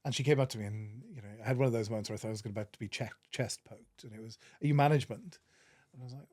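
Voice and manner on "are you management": imitating a brusque challenge, louder and higher; mock confrontational question, pitched up and punched out; confrontational demand. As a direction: demand it like an irate mum confronting staff. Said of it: "came storming towards me".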